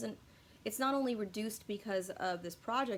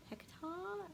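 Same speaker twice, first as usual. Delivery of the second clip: much higher, softer British hectare; bad British accent; 10 semitones higher, 10 dB quieter than usual. Shown as a demonstration. Vlogger trying the word again in a bad British accent. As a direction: ask Hectare? in a high, soft mock-British voice. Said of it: "say that word in a British accent".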